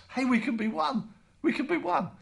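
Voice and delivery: joyful exclamation. Retold or imitated. Joyful realisation of unity.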